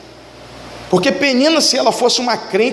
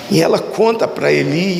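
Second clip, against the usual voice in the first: ordinary talk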